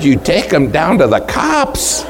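high, astonished echo of what was just said; incredulous question. Retold or imitated. Preacher retelling his shock at the police trip, incredulous.

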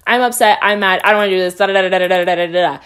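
imitating an unfiltered morning rant; venting brain dump. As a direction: rattle off complaints, trailing into babble. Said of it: "dump what was in my brain".

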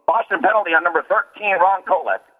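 high-pitched arena PA penalty announcer; sports announcing voice. Shown as a demonstration. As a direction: rattle off each penalty like a Boston Garden announcer, pitch lifted. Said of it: "in my sports announcing voice".

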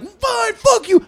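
high, loud mock tantrum; mad. Boyfriend to girlfriend over the intercom, mad. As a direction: explode in a squealed imitation of rage. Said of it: "he gets so mad".

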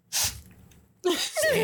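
a squeaked, very high small yes; a hint of a frightened son confessing; tiny high yes. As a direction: answer like a scared kid caught out. Timid answer to his father.

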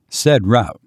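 plain reading voice

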